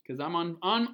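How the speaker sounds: plain reading voice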